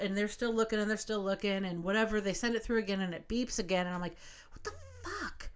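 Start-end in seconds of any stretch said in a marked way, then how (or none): 4.5-5.5 much higher, much softer disbelieving what the fuck; a strangled high whisper of disbelief; incredulous squeak. Retold or imitated. Baffled when it beeps again.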